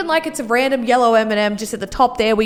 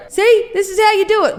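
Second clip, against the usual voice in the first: mock teacher demo; 7 semitones higher than usual. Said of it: "and just go".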